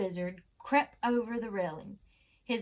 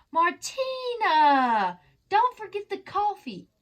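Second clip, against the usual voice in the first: long, drawn-out call from afar, then higher; 8 semitones higher, 6 dB louder, slower than usual. Acted. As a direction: call her granddaughter from the balcony, stretched out. Grandmother calling from above.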